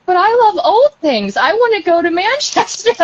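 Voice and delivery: high-pitched, playful plea; mock pleading that ends in a laugh; imitated eager pleading. Voices younger self pleading with great-aunt.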